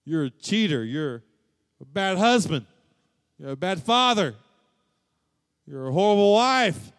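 loud, high, scornful accuser; accusing voice. Acted. People condemning the listener for past sins, in a loud, scornful accusing voice. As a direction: hurl each label like an accusation. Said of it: "people may look at you and say".

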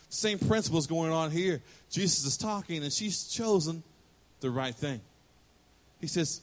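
ordinary delivery

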